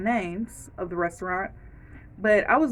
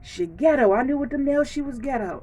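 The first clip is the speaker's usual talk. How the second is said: gossiping onlooker; 3 semitones higher than usual. Retold or imitated. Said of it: "I don't want y'all to be like".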